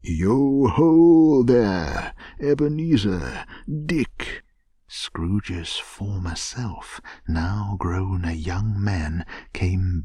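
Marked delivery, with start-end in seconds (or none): 0.0-4.4 rich, fat, comfortable hearty holler; jovial voice. A narrator's reading. Old master calling his two apprentices, rich jovial voice. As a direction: call out high, loud and jovial, stretching it. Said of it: "called out in a comfortable, oily, rich, fat, jovial voice".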